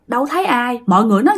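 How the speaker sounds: normal talking voice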